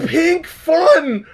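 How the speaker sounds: high, gleeful echo; delighted squeal. Real, not acted. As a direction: shriek it with delight. Echoing in delighted disbelief.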